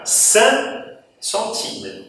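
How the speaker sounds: "san centime" said slowly, nasal san without the q; teacher showing the silent consonant; dropped final q. Shown as a demonstration. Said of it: "isso aqui que antes era cinq".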